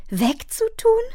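shocked question, swooping up at the end; horrified cry. A narrator's reading. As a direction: echo it in disbelief, voice rising. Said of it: "schrie Fern entsetzt". (entsetzte, ungläubige Nachfrage)

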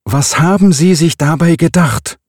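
high, loud furious bellow. Acted. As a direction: roar it at him. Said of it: "brüllte er weiter". (wütend gebrüllt)

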